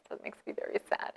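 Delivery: whispered, mock sad. Real, not acted. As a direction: breathe it out like a sad secret.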